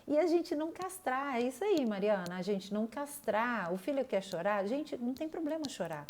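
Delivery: usual speaking voice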